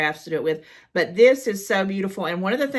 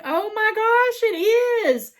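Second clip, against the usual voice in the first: delighted gasp; 5 dB louder than usual. Real, not acted. Squealing at a handmade card from a viewer. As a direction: gush over the handmade card.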